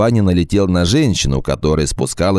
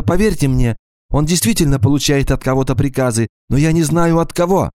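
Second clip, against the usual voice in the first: high, pleading frightened woman; a scared wife begging to be believed; frightened woman's voice; 9 semitones higher than usual. Acted.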